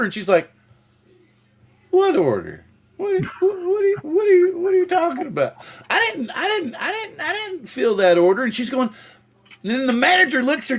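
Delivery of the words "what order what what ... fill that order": much higher, stammering mock worker; flustered denial. Worker caught by the manager, stammering a denial. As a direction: play dumb and panicky.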